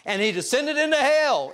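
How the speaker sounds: put-on a proud boy yelling out the creed line; shouts out. Memorizing boy shouts the creed line into the pause, loud shout. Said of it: "shouts out".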